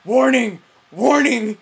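imitating Kevin's loud repeated warning; loud warning call. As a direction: bark out warning like an alarm. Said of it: "was Kevin going".

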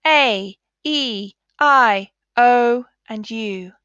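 "A, E, I, O, and U" as letter names, stretched slowly; long vowel sounds. Shown as a demonstration. Teacher drilling pupils on long vowels, slowly stretched. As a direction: stretch out each vowel name. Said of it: "say the long vowel sounds".

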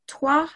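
trois ending on the open vowel; silent S. Shown as a demonstration. Teacher modelling trois for the class, final S silent. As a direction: say trois with no S at the end. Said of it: "you don't pronounce the, the S".

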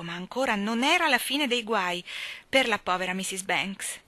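plain reading voice

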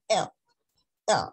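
letter "L" as a short dark "el"; soft English L. Shown as a demonstration. Teacher repeats correct letter L, soft English sound. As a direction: release "L" softly, tongue loose. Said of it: "pero suave".

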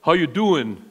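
low, casual Philly greeting; Philly accent. Acted. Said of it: "If you're from Philly".

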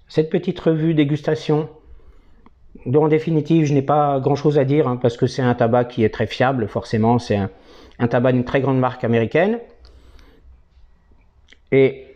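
talking around pipe stem; pipe in mouth. Real, not acted. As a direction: lips clenched on pipe. Seen 4: Pipe stem clenched in mouth while speaking; briefly removed at 14.4.

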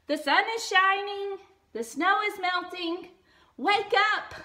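bubbling with spring news; excited Frog. A narrator's reading. Frog urges Toad to wake.

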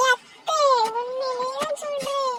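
put-on high, trembling-scared little girl, slowed at first; scared crying girl. Frightened girl pleads with her aunt, high and teary. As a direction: whimper that you're too scared to go in.

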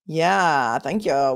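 ordinary talk

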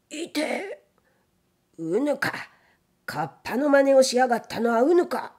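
high yelp, then rising accusation; startled, angry outcry. A narrator's reading. Gatekeeper grabbed by the hidden samurai, startled angry accusation. Gatekeeper seized, struggles and cries out.

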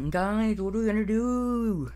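high-pitched anxious plea; high mock-helpless question; pretend worried whine. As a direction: pitch it high and fret to the group. Worried character asks the others.

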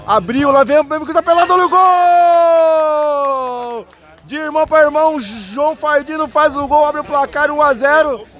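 high, slow, shouted goal call. Real, not acted. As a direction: belt out the goal as it lands. (grito de gol empolgado)

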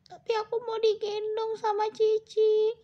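high little cat voice; pleading cat. Pretend. Cat begs to be carried by Cici, high pleading cat voice.

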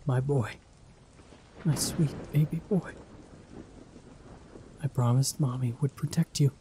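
put-on sobbing sorrow to her child; tearful apology. Mother kneeling by her dead son, tearful whisper.

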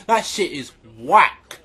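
dropped low and slowed, That shit is whack; deep slow repeat. Acted. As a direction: drag 'whack' out deep and slow. Repeated line, deep slow take.

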